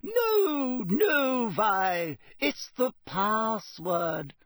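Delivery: high, soothing portrait lady; calm her friend down slowly. Acted. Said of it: "said the fat lady soothingly".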